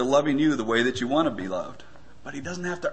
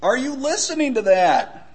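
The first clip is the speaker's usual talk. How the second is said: higher-pitched retold yell; calling out higher, with mock impatience; a little louder; 9 semitones higher, 3 dB louder than usual. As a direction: repeat the call, raised and more insistent. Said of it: "a little louder".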